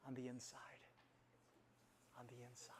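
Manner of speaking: low, very soft whisper; hushed whisper. Real, not acted. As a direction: let it sink in as a whispered secret. Hushed answer to own question.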